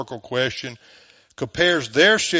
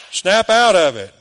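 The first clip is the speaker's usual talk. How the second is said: stern shout; 5 semitones higher, 7 dB louder than usual. Acted. Voicing Paul scolding Galatians.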